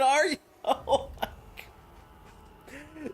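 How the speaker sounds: airy laugh-whisper, slow; breathless laughing. Real, not acted. Streamer overwhelmed by a donor, breathless laughing.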